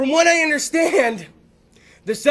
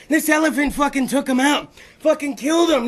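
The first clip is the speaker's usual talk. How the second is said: ordinary delivery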